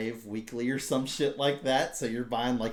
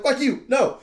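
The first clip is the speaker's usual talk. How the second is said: panicked refusal; 13 semitones higher, 10 dB louder than usual. Retold or imitated. Retelling freaking out at the game.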